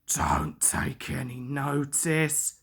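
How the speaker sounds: soft, slow and low; calm him gently; soothing. A narrator's reading. Edward soothes cross Duck, soft and slow. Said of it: "soothed Edward".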